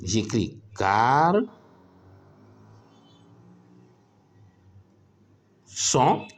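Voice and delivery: "gar-" then a long pause before "son", slowly; teacher spelling out "gar- son" slowly for the class; syllable by syllable. Shown as a demonstration. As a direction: split "garçon" into "gar-" and "son" with a gap. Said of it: "le cas de garçon".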